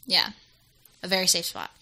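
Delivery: quietly sarcastic; soft, understated sarcasm. Real, not acted. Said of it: "You said that sarcasm-, sarcastically".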